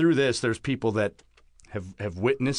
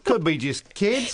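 higher, louder, slow put-on Cockney; Cockney accent; 11 semitones higher, 5 dB louder, slower than usual. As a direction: drawl it like a Cockney sheriff. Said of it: "He's Cockney".

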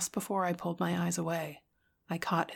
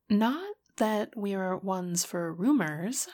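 put-on gossipy girl; 3 semitones higher, 5 dB louder, much slower than usual. Caroline gossiping, drawn out.

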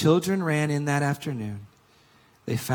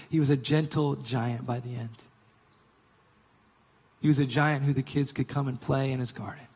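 usual speaking voice

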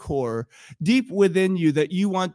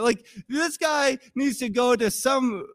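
ordinary talk

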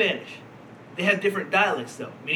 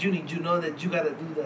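put-on softer, accented take on a dad; dad's accent, D's like J's; 4 semitones lower, 5 dB quieter than usual. Friend demonstrates how the dad talks, accent with D's like J's. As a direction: imitate a father's accent, D's becoming J's. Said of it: "he pronounces the D's like J's".

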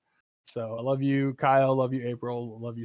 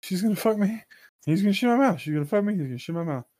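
mock panic; 6 semitones higher, 3 dB louder, faster than usual. Panicked mock rant after the threat.

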